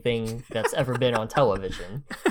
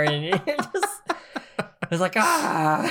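exasperated; 7 semitones higher than usual. Real, not acted. Exasperated about sticky kids.

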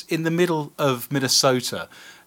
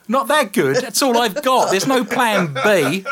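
higher-pitched, hammed-up indignant protest; mock indignation; 8 semitones higher, 4 dB louder, slower than usual. Briton told his real accent needs work, mock indignant. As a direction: protest the insult in a raised, incredulous voice.

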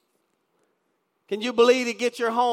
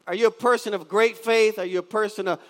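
ordinary talk, nothing marked

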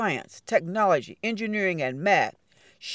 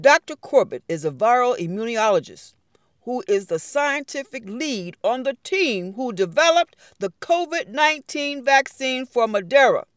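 plain reading voice

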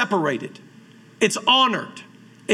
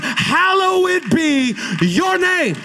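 much higher, slow, drawn-out shouted hallowed; preached shout; 7 semitones higher, much slower than usual. Acted. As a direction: lift each word to the rafters, slowly. Preacher exalting God's name.

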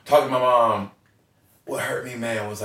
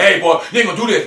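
quick, loud, higher mock scolding of the dad; 9 semitones higher, 9 dB louder, faster than usual. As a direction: rattle off the dad's warning, half-joking. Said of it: "I would talk to him like".

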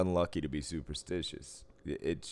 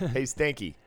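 mock taunt; 8 semitones higher, 5 dB louder than usual. Mocking a smelly poker player.

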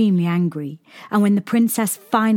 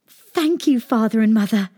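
grateful princess; 4 semitones higher, 4 dB louder than usual. A narrator's reading. Princess thanks her parents.

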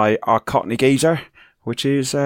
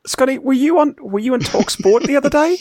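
a higher, hammed-up Northern English accent; mock Northern accent again on request. Host repeats his radio jibe on request, hammed-up Northern accent. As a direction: redo the line in a jokey Northern accent. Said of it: "Do that again".

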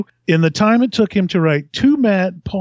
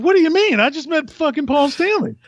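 excited protest; 11 semitones higher than usual. Retold or imitated. Retelling his defensive reply.

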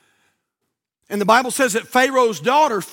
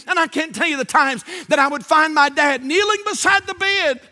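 high, driving pulpit delivery; raised preacher's cadence; impassioned preaching; 7 semitones higher than usual. Real, not acted. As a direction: preach it with mounting fervor. Preacher at full force.

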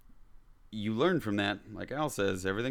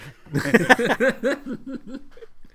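burst of laughter; 9 dB louder than usual. Real, not acted. Laughs at own bad accent.

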